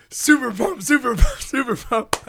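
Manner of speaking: high-pitched hype chant, volume held back; mock screaming. Podcaster imitating his friend pumping his arms, restrained mock scream. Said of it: "screaming super pumped as loud".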